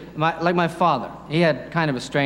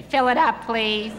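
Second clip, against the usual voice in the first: higher put-on trucker at the gas pump; 7 semitones higher, slower than usual. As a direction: order the fill-up like a burly trucker. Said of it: "all truck drivers talk like that".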